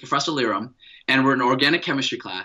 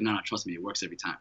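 friend's low reassurance; 8 semitones lower, 11 dB quieter, much faster than usual. Retold or imitated. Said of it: "He's like".

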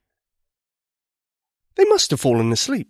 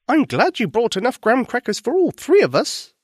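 high dragon voice; 10 semitones higher than usual. Acted. Dragon speaks in story.

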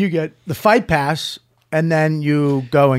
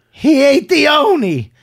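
high, loud and slow, a hammed-up cry; mock shriek of the sister; 11 semitones higher, 6 dB louder, slower than usual. Retelling the sister's cake scene from Fatso, high mock wail. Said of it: "she goes".

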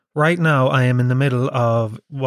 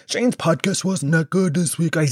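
put-on complaining listener voice; mocking whiner; 3 semitones higher than usual. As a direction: mimic a petty critic. Mocking the complaining listeners once more.

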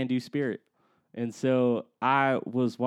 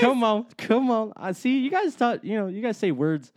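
mock indignant; 10 semitones higher, 5 dB louder than usual. Mock indignant, high pitch.

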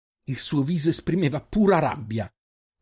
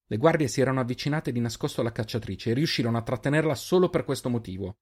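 usual speaking voice

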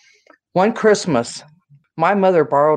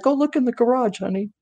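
coaxing mother; 8 semitones higher, 3 dB quieter than usual. Retold or imitated. Mother luring brother to surprise.

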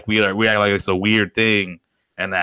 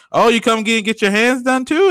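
mock salon worker; 12 semitones higher, 4 dB louder than usual. Mocking salon staff upsell.

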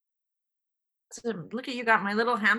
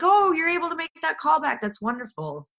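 high, bright imitation of the director, much higher and louder; mock cheery director; 11 semitones higher, 5 dB louder than usual. Director praising the actress after the AD's remark, mock cheery. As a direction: play the oblivious director gushing. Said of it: "And the director's like".

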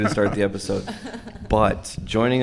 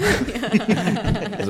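laughing; 13 semitones higher than usual. Real, not acted. Joking aside laughing.

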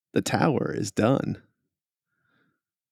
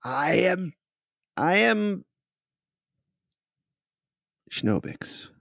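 genie; 10 semitones higher, slower than usual. Acted. Freed clone answers its new master.

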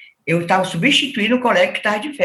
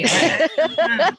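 hesitant self-quote; 8 semitones higher than usual. Retold or imitated. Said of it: "Eu dizia assim".

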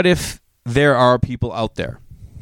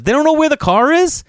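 exasperated; 4 dB louder than usual. Real, not acted. Exasperated rant about 911.

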